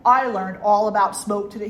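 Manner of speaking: loud, earnest schoolkid announcement; emphatic kid being very serious; serious little boy. Retold or imitated. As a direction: imitate a child lecturing a parent. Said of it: "he was all serious and he went".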